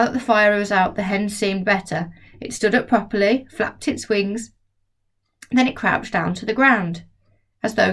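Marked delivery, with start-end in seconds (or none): none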